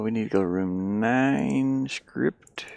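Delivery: ordinary talk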